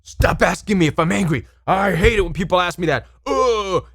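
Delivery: mock the narcissist's fed-up whine; exasperated narcissist ending on a loud ugh; irritated narcissist. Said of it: "Narcissists are like".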